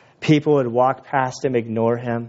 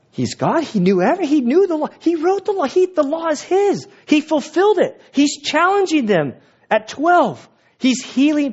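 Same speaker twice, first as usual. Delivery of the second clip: much higher, rising with wonder; excited build-up; 13 semitones higher than usual. Real, not acted. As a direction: lift it high as the awe grows. Pastor building up about Jesus.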